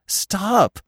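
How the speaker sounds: high, lightly breathless call; panting. A narrator's reading. Bambi out of breath chasing Feline, panting. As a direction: call out as if winded. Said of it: "Bambi panted".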